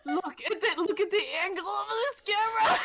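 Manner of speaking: high, squealing laugh through words; laughing so hard you almost cry. Real, not acted. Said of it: "I'm starting to cry".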